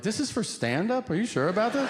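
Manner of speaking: high-pitched, disbelieving questions; retold incredulity. Comic questioning promoters about a lavish offer, incredulous. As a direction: query it as if they must be mistaken.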